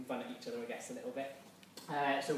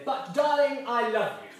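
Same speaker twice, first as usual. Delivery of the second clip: high, hammed-up posh gush on darling; a mock-grand declaration of love; 7 semitones higher, 7 dB louder, slower than usual. Shown as a demonstration. Leader shows the posh reply to the circle, gushing. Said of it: "in your most posh voice".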